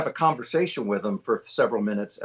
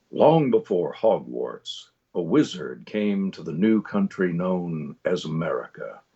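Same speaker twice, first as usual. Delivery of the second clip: slow, low storyteller with a hint of Midwest; conversational trailer read; 7 semitones lower, slower than usual. Shown as a demonstration. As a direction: narrate a film trailer, low and unhurried. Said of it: "just the minutest hint".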